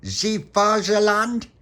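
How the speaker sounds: "Sie Vaterland" with a German accent, high, loud and slow; German pronunciation. Shown as a demonstration. Pop showing how Germans say fatherland, mock German accent. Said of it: "They go, like that".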